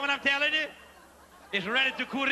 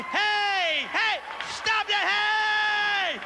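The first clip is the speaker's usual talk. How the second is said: louder, higher frantic yell of hey stop that; 8 semitones higher, 5 dB louder, much slower than usual. Retold or imitated. In the stall, yelling at the man next to him, frantic shout. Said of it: "I'm yelling at the man next to me".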